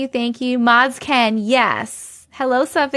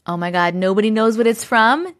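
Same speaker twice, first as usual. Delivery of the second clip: ordinary talk, nothing marked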